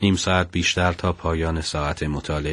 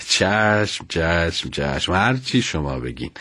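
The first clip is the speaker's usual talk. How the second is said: laughing surrender, pitch dropping as it goes; 2 semitones lower, slower than usual. A narrator's reading. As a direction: give in playfully through a chuckle. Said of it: "با خنده گفت".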